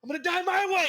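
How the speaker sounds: a high, loud, defiant final vow; defiant retold line. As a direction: shout the last words up high like a movie hero. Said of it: "He's like".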